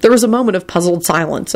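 usual speaking voice

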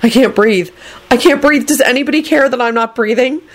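high, rising panic; panicked high protest; frantic. A narrator's reading. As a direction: beg someone to care, pitch climbing. Frantic thoughts while choking.

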